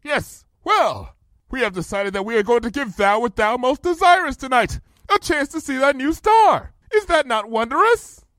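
high, projected regal declaration; grand jovial princess. A narrator's reading. Princess proudly offers her friend the new star, jovial regal call. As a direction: announce a gift grandly across a distance.

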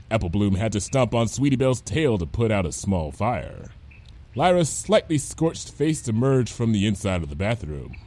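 ordinary delivery, nothing marked

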